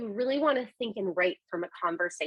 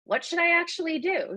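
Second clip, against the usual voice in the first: higher-pitched puzzled question; wondering; 9 semitones higher than usual. Acted. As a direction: ask it as a confused user would. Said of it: "me, is going to wonder".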